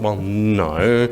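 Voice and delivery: ordinary delivery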